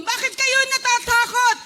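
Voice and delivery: loud, high rebuke fading slow at the end; Jesus rebuking the disciples, loud then slowing; stern rebuke. Acted. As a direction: scold them for their little faith. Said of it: "ng Panginoon".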